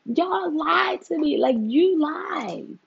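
high, loud, slow accusing; mock outrage. Real, not acted. Vlogger scolding friends who lied about being busy. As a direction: drag out the accusation with disbelief.

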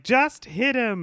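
high, loud, drawn-out urging; mock impatient order. Kendra urging Buffy during the shakedown, mock impatient. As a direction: imitate an exasperated push to use force.

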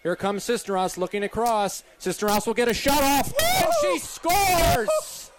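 screaming goal call, pitch soaring; frantic sports commentary. Real, not acted. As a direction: yell the goal like the stadium's erupting.

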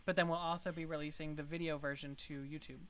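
ordinary talk

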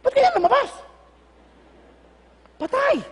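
mock panic over a mistake; comic dismay. Lord voiced in comic dismay. (pabirong pagkataranta)

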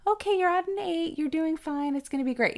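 high, reassuring doctor voice; imitated chipper bedside manner; upbeat doctor.

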